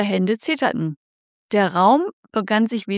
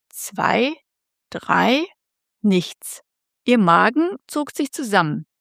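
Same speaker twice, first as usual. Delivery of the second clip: ordinary delivery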